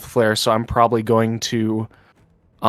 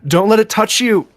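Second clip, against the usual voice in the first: raised, high warning cry; calling out; 8 semitones higher, 4 dB louder, faster than usual. Acted. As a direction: call a warning across the battlefield. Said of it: "just kinda call".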